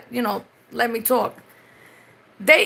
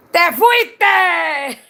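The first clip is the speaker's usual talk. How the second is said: laughing shout; 4 semitones higher, 4 dB louder than usual. Retold or imitated. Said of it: "I be like".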